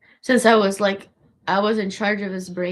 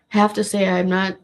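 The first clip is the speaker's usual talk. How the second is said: ordinary talk, nothing marked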